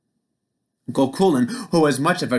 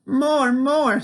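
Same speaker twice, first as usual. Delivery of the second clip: put-on much higher baby demand; greedy baby; 13 semitones higher than usual. A narrator's reading. Finn as baby asking for bread, high whiny voice. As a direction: beg like a baby. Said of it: "said the baby".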